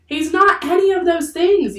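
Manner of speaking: high, loud, indignant denial; yelled protest. A narrator's reading. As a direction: yell over the crowd to defend him. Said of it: "yelled Annie".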